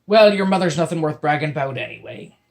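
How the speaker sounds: loud venomous pirate; venomous spat. Acted. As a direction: spit it out with spite. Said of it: "Bloody John spat venomously".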